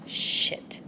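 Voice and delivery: breathy whispered swear under the breath; a dismayed curse, barely voiced; hushed dismayed curse. Realizing the rival is brilliant.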